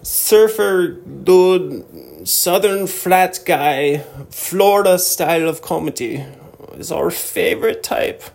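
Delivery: high, slow, drawn-out fake Austrian accent; mock Austrian accent. Host imitating Austrians who love a Florida comedian, mock Austrian accent. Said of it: "And they're like".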